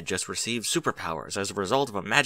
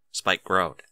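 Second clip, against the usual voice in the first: plain reading voice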